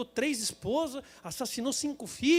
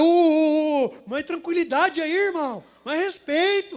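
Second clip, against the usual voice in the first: high, loud street-tough calling for respect; tough guy; 5 semitones higher, 10 dB louder, slower than usual. Retold or imitated. Squeegee man demanding respect from the driver, loud. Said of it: "O cara".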